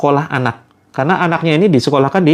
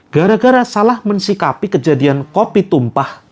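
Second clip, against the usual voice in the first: ordinary talk, nothing marked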